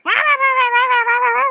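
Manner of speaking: loud, high-pitched mocking wah-wah; yapping imitation. Streamer mimics her rage as yapping chihuahuas, loud mocking babble. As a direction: drown her out with shrill yapping noise. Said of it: "like a bunch of yapping chihuahuas".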